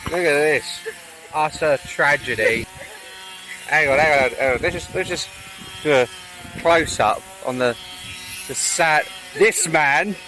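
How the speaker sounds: hammed-up tragic outrage; mock dramatic. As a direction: play it like a grand tragedy. Mock tragedy over broken swing ball.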